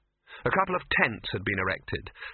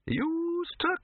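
put-on high, slow quavering old man; ancient old wizard; much slower than usual. A narrator's reading. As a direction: reminisce in a very high, doddery voice.

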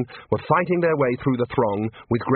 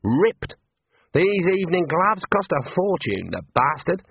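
affronted performer's complaint; raised-pitch outrage, not shouted; indignant demand; 5 semitones higher than usual. A narrator's reading. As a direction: complain indignantly about the damage. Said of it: "Darlene demanded".

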